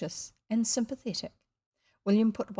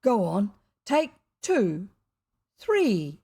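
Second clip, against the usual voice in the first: recklessly generous; 6 dB louder, slower than usual. A narrator's reading. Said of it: "in reckless generosity".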